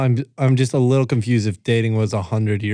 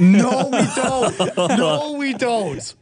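high-pitched, drawn-out refusal; emphatic protest; 9 semitones higher, much slower than usual. Real, not acted. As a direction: shoot the idea down, stretching it out. Vehemently rejecting the suggested episode.

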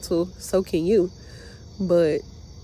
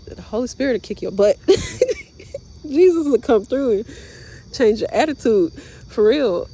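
emphatic preaching; 5 semitones higher, 4 dB louder than usual. Real, not acted. Preachy emphatic aside about Jesus.

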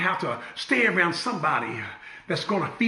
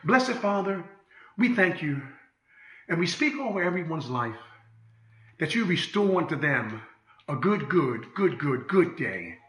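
soft, hushed prayer voice; gentle pastoral prayer; closing prayer; 3 semitones lower, 4 dB quieter than usual. Acted. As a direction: pray over the congregation.

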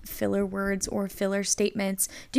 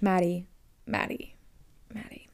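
self-scolding; 3 semitones lower, 6 dB quieter than usual. Real, not acted. Scolding herself for repeating.

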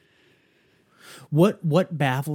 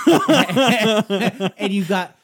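laughing; 5 semitones higher, much slower than usual. Real, not acted. Laughing while telling the story.

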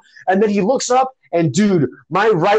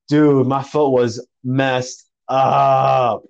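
normal talking voice

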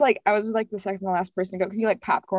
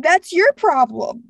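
sassy retort; 8 semitones higher, 7 dB louder, much slower than usual. Retold or imitated.

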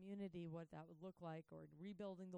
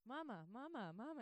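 a lightly higher, sing-song mama chant; nagging little kids; 5 semitones higher, 5 dB louder than usual. Retold or imitated. Kids pestering a parent nonstop, lightly mocked. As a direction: mimic the endless toddler nagging. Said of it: "they have nothing to say".

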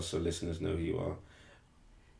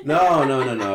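laughing; 7 semitones higher, 13 dB louder than usual. Real, not acted. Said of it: "protesting while laughing".

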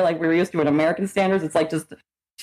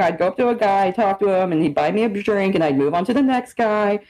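ordinary delivery

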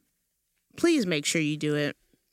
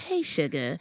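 put-on old Southern lady. Shown as a demonstration. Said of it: "old lady voice, my old Southern".

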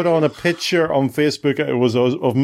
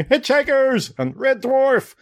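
mock shout of show titles, pitched high; half-hearted high yell of the titles; retold shouting; 12 semitones higher, slower than usual. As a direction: playfully reenact yelling the titles. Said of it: "I kept shouting".

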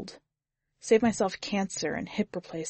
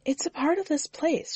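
lightly raised, calm woman's voice; Susie's voice; 10 semitones higher than usual. A narrator's reading. Susie speaks in story.

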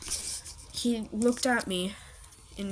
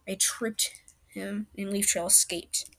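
usual speaking voice

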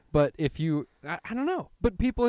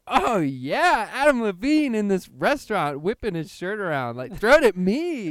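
loud, high fangirl squeal; excited fan; 7 semitones higher, 7 dB louder than usual. Retold or imitated. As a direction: squeal at the star. Crowd fan calling out.

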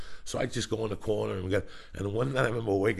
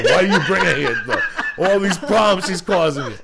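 mock whiny girlfriend; 8 semitones higher, 11 dB louder than usual. Mocking girlfriend's complaint.

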